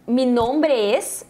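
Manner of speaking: slowed-down model phrase; slow careful Spanish. Shown as a demonstration. Teacher modelling mi nombre es slowly for the class. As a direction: say mi nombre es slowly and clearly for students. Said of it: "então eu posso dizer".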